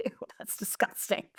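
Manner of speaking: whispered ew of disgust. Retold or imitated. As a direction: breathe out disgust under her breath. Reacting to sewage backup.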